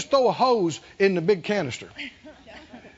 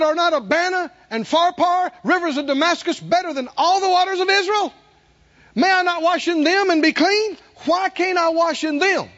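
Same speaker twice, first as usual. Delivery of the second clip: higher, louder indignant protest; indignant Naaman; 8 semitones higher, 3 dB louder than usual. Acted. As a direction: throw out his outraged objection. Naaman's angry retort, acted out.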